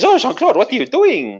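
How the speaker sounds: put-on high-pitched French-accented protest; startled Frenchwoman. Woman questioning her lover mid-seduction, startled. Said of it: "He goes".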